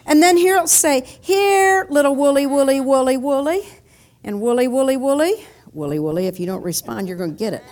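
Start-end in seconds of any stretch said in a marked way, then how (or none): 1.2-5.5 loud singsong call, long first word; shepherd calling sheep. Acted. Shepherd calls his woolly sheep to come, singsong calling. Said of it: "he begins to call his little sheepy". 5.7-7.6 mock threat. Shepherd warns sheep that won't respond, mock threatening.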